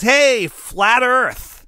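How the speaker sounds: high, loud, drawn-out mock greeting. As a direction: call it out high and slow like a teasing shout. Woman greets him mockingly at market.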